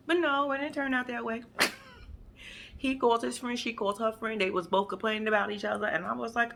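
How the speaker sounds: ordinary talk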